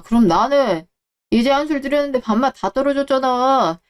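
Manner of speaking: usual speaking voice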